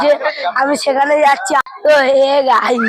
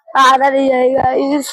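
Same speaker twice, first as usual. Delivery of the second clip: normal talking voice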